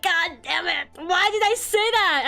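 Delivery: high, mock-anguished self-reproach; dramatized pained regret; retold exasperation. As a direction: act out kicking yourself. Acts out own regret.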